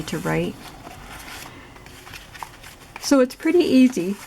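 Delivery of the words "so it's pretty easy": words stretched through a yawn. Real, not acted. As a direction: talk while yawning. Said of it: "Excuse me, I'm yawning again".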